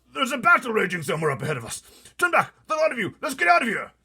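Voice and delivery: high, loud, fast shout; panicking army chief. Acted. Army head yelling at the troops to turn back. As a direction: general ordering retreat in terror.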